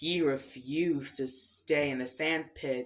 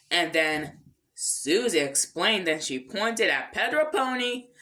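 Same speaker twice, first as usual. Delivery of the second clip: ordinary delivery, nothing marked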